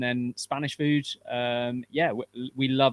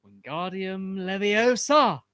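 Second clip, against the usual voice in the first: slow, high, louder wizard chant of "Wingardium Leviosa"; spell incantation; 8 semitones higher, 6 dB louder, slower than usual. Pretend. Guest casts the spell for a viewer, as a grand wizard chant. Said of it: "Can [name] say Wingardium Leviosa".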